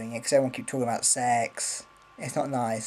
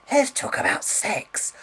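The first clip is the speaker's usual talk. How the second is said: mock sultry; 9 semitones higher than usual. Mocking sex-obsessed viewers.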